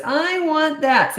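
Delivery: high, stretched-out whine; whining child. Shown as a demonstration. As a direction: drag the words out like a whiny five-year-old. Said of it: "when you talk like this".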